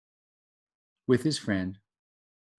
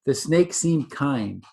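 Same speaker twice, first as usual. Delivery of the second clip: usual speaking voice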